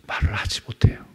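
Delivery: hushed, breathless whisper. Shown as a demonstration. Powerless can't speak, whispered.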